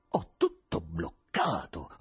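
low, slow, growled line; a restrained roar of frustration. A narrator's reading. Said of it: "ruggiva Rimsky". (ruggito basso e lento, trattenuto)

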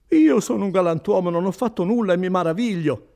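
loud, high outraged voice; indignant protest. Acted. Renzo tells the officers he is innocent, indignant. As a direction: protest your honour at full voice.

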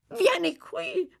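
very high crazed call; high-pitched eager old rogue; urgent beckoning. Acted. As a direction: summon the boy insistently.

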